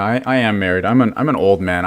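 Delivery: usual speaking voice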